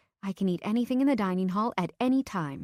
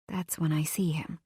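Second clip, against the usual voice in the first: whispered; 2 semitones lower than usual. A narrator's reading. Dramatic reveal, whispered.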